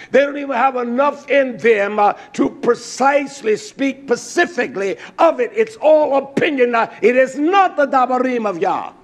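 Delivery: driving, emphatic sermon delivery; full-force preaching. Real, not acted. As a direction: preach it hard, hammering each repeated phrase.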